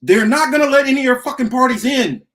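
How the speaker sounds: loud, furious outburst at the listeners; angry rant. Real, not acted. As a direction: shout it down at them in anger. Ranting at third-party hopefuls.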